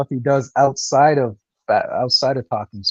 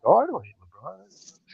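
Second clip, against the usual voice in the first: higher, softer, drawn-out mock denial; Elder feigning innocence; 6 semitones higher, 5 dB quieter than usual. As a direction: imitate him slowly disguising his dislike. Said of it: "he tries to disguise it like".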